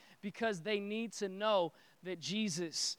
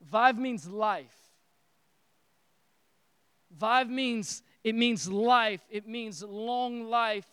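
emphatic; 7 dB louder, slower than usual. Acted. Preacher drives home key phrase.

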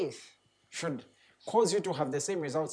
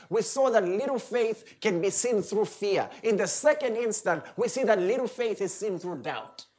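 ordinary talk, nothing marked

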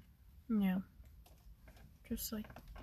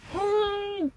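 sharp gasp of surprise; surprised gasp; 10 semitones higher than usual. Real, not acted. Reacts to the bangs story.

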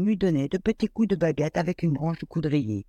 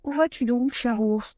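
higher, lightly voiced young girl; little girl; 8 semitones higher than usual. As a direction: ask it like a small worried child. Little girl asks bear.

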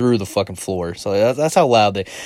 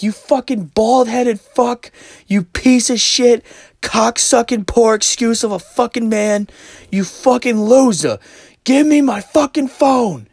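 high, loud, slow string of insults; each insult drawn out in a mock shriek; imitated screaming; 7 dB louder, slower than usual. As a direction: mimic an enraged woman screaming abuse. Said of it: "like, intense arguments".

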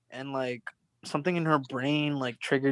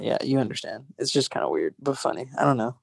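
ordinary delivery

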